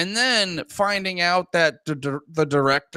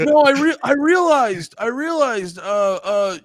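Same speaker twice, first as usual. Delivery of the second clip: high-pitched hammed-up pleading voice; mock earnest confession; 7 semitones higher than usual. Leader explains himself to gang, mock earnest confession. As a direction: plead your case in a high, earnest put-on voice. Playing the reformed lead, pleading.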